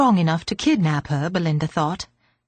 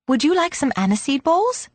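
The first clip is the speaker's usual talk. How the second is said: put-on higher, eager little girl; 6 semitones higher than usual. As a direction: offer it sweetly like a child. Girl offers sweets.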